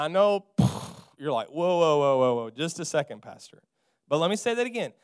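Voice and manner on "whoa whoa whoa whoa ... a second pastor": mock objection, dropping low in pitch; startled objector. Congregant interrupts the pastor's claim, low mock protest. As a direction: play a doubting listener putting on the brakes.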